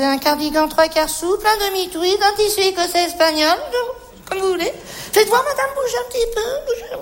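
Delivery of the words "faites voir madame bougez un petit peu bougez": high, laughing coaxing to move; giggly urging. Acted. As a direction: cheer her on, giggling high.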